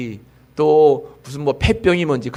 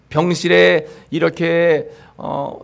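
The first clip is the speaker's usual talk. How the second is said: ordinary talk, nothing marked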